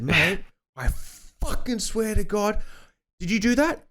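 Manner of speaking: high, strained imitated yell at the apprentice; furious foreman. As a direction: yell it like a foreman who has completely lost it. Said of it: "he just lost his shit".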